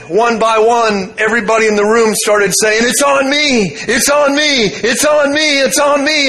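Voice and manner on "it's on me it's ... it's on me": excited shouts. Retold or imitated. People in the room shouting about the gold dust. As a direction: call it out in thrilled disbelief.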